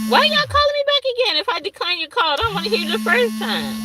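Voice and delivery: high, fast and fed up; exasperated outburst. Real, not acted. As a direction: snap at the scammer who keeps calling. Scam caller rings back again.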